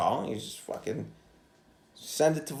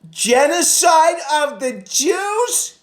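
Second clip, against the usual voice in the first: much higher, slow, drawn-out accusing question; mock outraged question; 8 dB louder, much slower than usual. Caller asking the receptionist, high and drawn out. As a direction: stretch it out, shocked and accusing. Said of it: "And so I just went".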